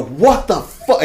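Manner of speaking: loud, slow, high shocked shout; drawn-out startled outburst. Retold or imitated. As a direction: act out yelling at the intruder. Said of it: "And I say this out loud".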